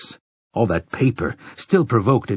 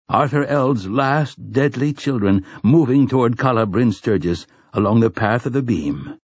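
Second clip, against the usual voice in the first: ordinary delivery, nothing marked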